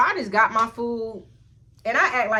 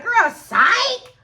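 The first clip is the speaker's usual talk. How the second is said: loud, high, taunting reveal; mocking gotcha; 12 semitones higher, 8 dB louder than usual. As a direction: imitate a mean girl springing the punchline. Mean girl springs her prank, retold.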